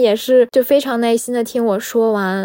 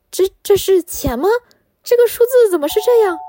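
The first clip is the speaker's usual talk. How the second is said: stunned disbelief; 8 semitones higher than usual. Retold or imitated. Staring at a huge balance.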